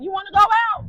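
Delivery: eager friend asking; friend inviting. Retold or imitated. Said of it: "So she was like".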